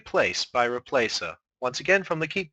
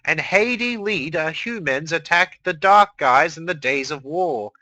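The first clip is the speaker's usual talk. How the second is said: higher, louder storyteller narration; dramatic story reading; 5 semitones higher, 4 dB louder than usual. Acted. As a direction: read it like an epic saga. Reads fan fiction dramatically.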